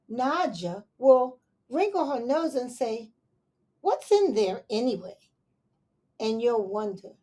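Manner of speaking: put-on gentle read-aloud to children; story reading. Reading a picture book aloud to children, gentle storytelling.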